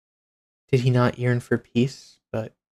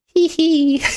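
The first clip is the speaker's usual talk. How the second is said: high, louder hee hee giggle; psychotic giggle; 5 dB louder than usual. Shown as a demonstration. Mocking an insane villain, high psychotic giggle. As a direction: giggle like a gleeful maniac. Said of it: "their evil psychotic laugh".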